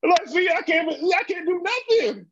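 high, slow whiny complaint; helpless old man. Retold or imitated. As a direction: whine about being useless.

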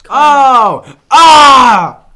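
sudden loud startled cry; startled shout. Real, not acted. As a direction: yelp in surprise. Candy bottle pops open.

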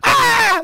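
short high mock shriek; mock scream. Mocking residents bothered by honking, high shriek. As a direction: shriek like you're under attack. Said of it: "screaming about".

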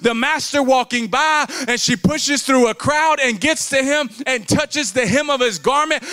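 loud, high, driving preacher's cry; full-force preaching. Acted. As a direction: preach it hard to the whole church.

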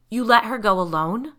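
loud accusing question; husband blaming Maurice, angry; angry demand. A narrator's reading. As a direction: accuse him of letting her go. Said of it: "Tyler demanded".

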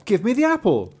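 put-on a small girl insisting; little girl's voice. Marlinkin demands the apple from brother in child voice.

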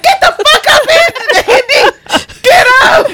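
loud high yelling; shouting. Retold or imitated. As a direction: yell at him to get up. Yelling to wake someone.

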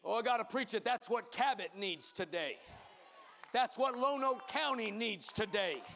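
full-force preaching. Acted. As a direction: build the congregation up, hammering each line.

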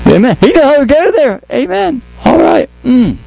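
very high, exultant amen; exultant high preacher amen; ecstatic amen. Real, not acted. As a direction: lift it up like a shout of praise.

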